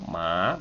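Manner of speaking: "ma" pitched low. Shown as a demonstration. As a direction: drop "ma" below the talking voice.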